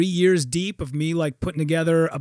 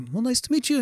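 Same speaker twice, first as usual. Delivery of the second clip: higher, restrained polite greeting; imitated courteous musician; 6 semitones higher, 3 dB quieter, faster than usual. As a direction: greet as the unruffled star. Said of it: "[name] stands up, and he's like".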